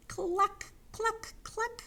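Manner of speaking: high hen clucks, spaced out; high-pitched chicken call; clucking hens. Pretend. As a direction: cluck like a hen, slowly one by one. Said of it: "the hens cried".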